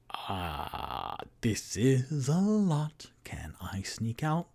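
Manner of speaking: slow, overwhelmed whisper; drawn-out hushed sigh of overload. Acted. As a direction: whisper a plea to escape. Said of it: "Toot Toot is just like".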